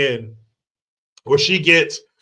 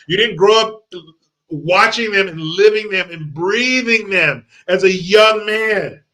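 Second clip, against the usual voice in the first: ordinary talk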